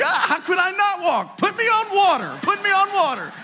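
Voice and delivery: put-on high, loud, laughing toddler voice; excited baby. Baby boasting after his first step, high and laughing. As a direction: play the proud toddler bursting with confidence. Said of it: "He's like".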